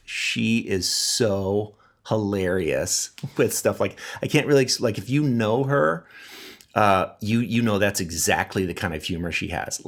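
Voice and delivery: normal talking voice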